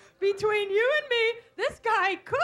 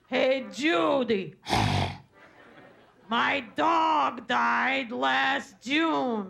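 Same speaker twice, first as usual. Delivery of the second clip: low, slow mournful drawl; droopy sad-sack guy; 8 semitones lower, much slower than usual. Retold or imitated. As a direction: play a slow droning bore. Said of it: "he's going".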